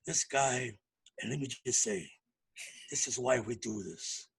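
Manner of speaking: deep, soft, hushed intro voice; low, reverent introduction of a guest. Retold or imitated. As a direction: drop the voice low like a solemn host. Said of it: "he'll lower his tone".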